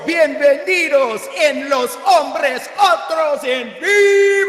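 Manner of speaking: booming slow Spanish ring announcer; lucha libre announcer. Acted. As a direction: roll it out like a wrestling MC. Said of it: "that's how you do an intro".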